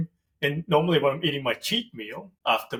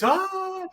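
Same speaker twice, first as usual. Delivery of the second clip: high excited squeal; fangirl squeal. Retold or imitated. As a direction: squeal like a starstruck lady. Ladies squeal at Prince Charming.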